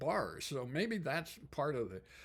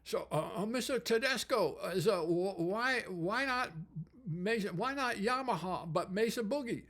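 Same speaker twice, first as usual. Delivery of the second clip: put-on higher, halting Japanese accent; Japanese engineer; 6 semitones higher than usual. Puzzled engineer questioning the guitarist, imitated Japanese accent. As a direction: put on a hesitant, high-pitched Japanese accent. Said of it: "the Japanese engineer says".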